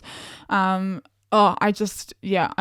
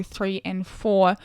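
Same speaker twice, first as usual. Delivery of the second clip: ordinary delivery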